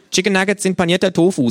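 high, loud cheeky kangaroo greeting. Acted. The kangaroo scolds the late narrator in its high cheeky voice. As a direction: snap it out as the brash kangaroo.

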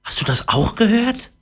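ordinary delivery

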